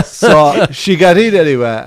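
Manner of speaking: still laughing. Real, not acted. Teller carrying on the story, still laughing. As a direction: push on through the giggles.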